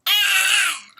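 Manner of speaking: high strangled gasp of pain; choked cry. Acted. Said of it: "he choked".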